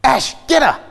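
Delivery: high, loud shouted command; mock yell. Farmer shouting at him to grab the ewe, high mock yell.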